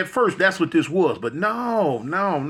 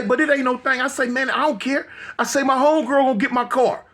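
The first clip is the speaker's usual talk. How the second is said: higher, raised voice bluster; loud bluster; 7 semitones higher than usual. Retold or imitated. Telling the police his homegirl will get the car, loud. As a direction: act unbothered but loud. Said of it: "So I get loud".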